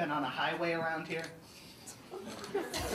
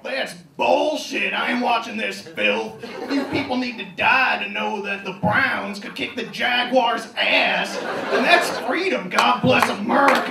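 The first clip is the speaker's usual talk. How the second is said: mock angry viewer; 6 semitones higher, 11 dB louder than usual. Imagined viewer yelling back at the news, furious. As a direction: rant like an outraged patriot yelling at the TV. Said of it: "sitting at home saying".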